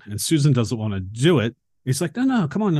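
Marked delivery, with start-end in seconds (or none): 2.2-2.7 higher, playful coaxing; eager kid. Retold or imitated. Josh coaxing reluctant Susan, higher playful kid voice. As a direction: coax like a kid who won't take no.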